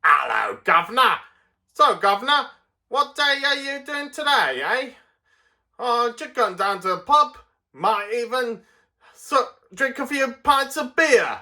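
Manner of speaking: high-pitched, hammed-up Cockney; Cockney accent. Shown as a demonstration. Cockney chatting to a governor about the pub, broad Cockney accent. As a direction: play a chirpy Cockney chatting in the street. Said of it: "doing a real Cockney accent".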